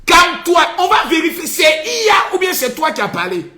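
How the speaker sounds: high, loud, fast scolding; exasperated yelling. Pretend. Mock doctor scolding a crying Tiani, exasperated yelling. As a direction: shout him down, fed up.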